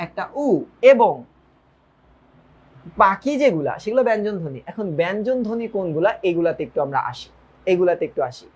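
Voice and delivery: ordinary delivery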